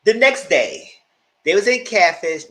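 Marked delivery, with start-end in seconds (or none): 1.5-2.5 louder, higher first try; slip. Real, not acted. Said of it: "No, they, they was in Catfish".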